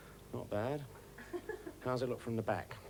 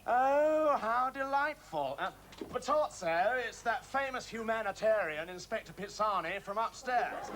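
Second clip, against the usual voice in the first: high, loud gushing announcement; mock delight; 10 semitones higher, 5 dB louder than usual. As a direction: gush in exaggerated delight to the room. Mock delight at the phone caller.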